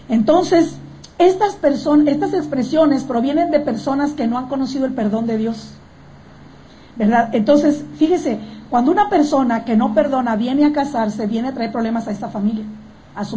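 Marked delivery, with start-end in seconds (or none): none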